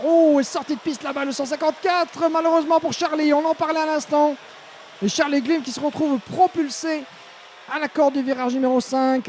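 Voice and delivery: high, urgent race call; motorsport commentator. Real, not acted. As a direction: call the crash with alarm. Kart goes off the track.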